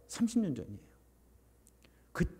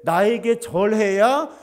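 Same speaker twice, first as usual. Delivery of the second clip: loud, slow, higher commanding offer; tempter's demand; 5 semitones higher, 8 dB louder, slower than usual. Acted. Satan demanding Jesus bow to him, loud and slow. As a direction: boom out the bargain like a tempter. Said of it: "하고 속이는 거예요".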